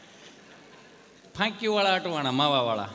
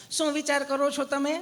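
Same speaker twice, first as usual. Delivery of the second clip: sweet nurse voice; 7 semitones higher than usual. Retold or imitated. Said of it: "તમે કેટલું પ્રેમથી પૂછો છો બેન".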